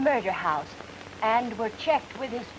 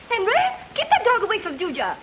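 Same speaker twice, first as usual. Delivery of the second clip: higher, scolding housewife voice; mother's voice; 8 semitones higher than usual. Pretend. Woman stalling a detective with a one-woman radio act, as a scolding mother. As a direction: play the fussy mother in a radio skit. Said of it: "I play all the voices".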